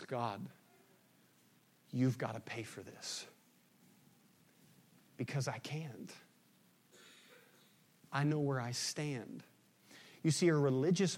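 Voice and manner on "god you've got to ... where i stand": humble quiet prayer. Acted. Humble tax collector praying.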